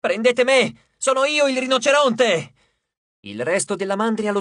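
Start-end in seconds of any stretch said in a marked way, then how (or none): 0.0-2.5 loud, higher yelled challenge; shouting. A narrator's reading. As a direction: yell to draw the herd onto himself. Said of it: "urlò Frank". (grido di sfida forte e acuto)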